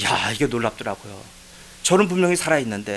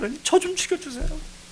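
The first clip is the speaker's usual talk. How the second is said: retold desperate prayer; 4 dB quieter than usual. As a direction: reenact begging God at the top of your range. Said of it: "목청 높여 떠들었어요". (높은 목소리로 애원하는 기도 재연)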